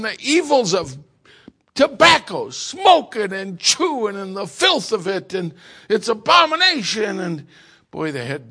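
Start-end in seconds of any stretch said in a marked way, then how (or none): 0.0-7.2 imitating a preacher, much louder and higher; hammed-up pulpit thunder against sin; mock fiery preaching. Pastor preaching hard against tobacco.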